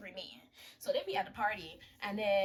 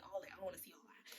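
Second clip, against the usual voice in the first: mock whisper; 13 dB quieter, faster than usual. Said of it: "he's like".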